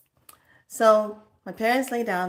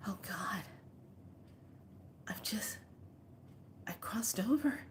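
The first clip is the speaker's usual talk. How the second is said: hushed, scared whisper to herself; frightened whisper; 2 semitones higher, 16 dB quieter than usual. Retold or imitated. Mom alone in the dark, a scared whisper. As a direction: whisper as if afraid to disturb the dark. Mom thinks she died in sleep.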